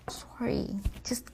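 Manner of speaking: whispered sorry. Acted. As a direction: breathe the apology. Whispered apology.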